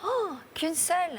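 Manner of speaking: high, slow "oh" of surprise; teasing disappointment. Real, not acted. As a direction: playfully let down, pitched high. Host teases about a single night.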